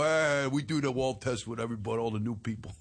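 lightly voiced dumb fighter; a hint of a thick-headed boxer. Ghost boxer explaining the prank, as a dumb boxer. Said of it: "I played a dumb boxer".

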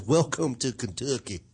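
slow, mild Kentucky drawl; understated Southern accent imitation. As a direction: read the sign with a slight Kentucky twang. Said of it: "It has an accent".

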